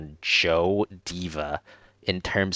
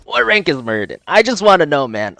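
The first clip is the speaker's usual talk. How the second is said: high, loud outburst of frustration; exasperated rant; 12 semitones higher, 10 dB louder than usual. Real, not acted. Streamer blaming matchmaking for griefers on their team, high exasperated shouting. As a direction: shout it in real exasperation at useless teammates. Raging at teammates during the game.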